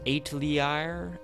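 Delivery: "atelier" sounded out slowly as "ate-lee-eye-er"; slow, puzzled stab at "atelier"; unsure pronunciation. Real, not acted. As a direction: stretch "atelier" out, guessing at it with a questioning lift. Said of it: "tell me to pronounce this".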